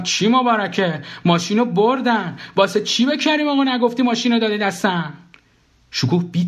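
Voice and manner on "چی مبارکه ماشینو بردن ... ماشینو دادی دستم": high, exasperated retort; high-pitched, indignant questioning; exasperated protest. Acted. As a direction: protest that it's a disaster. Acted protest over stolen car.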